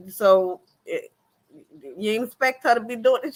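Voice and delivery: ordinary delivery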